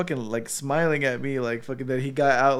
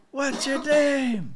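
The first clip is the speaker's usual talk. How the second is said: high, slow, stretched-out call; drawn-out guy voice; 11 semitones higher, much slower than usual. Retold or imitated. Friend retells a viral video, slow high drawl. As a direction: stretch the question out like a guy yelling from a roof. Imitating man on a roof.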